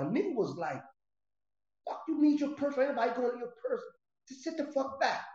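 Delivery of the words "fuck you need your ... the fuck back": angry yell. Retold or imitated. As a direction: bark her back down from the door. Dre yelling at girlfriend through door.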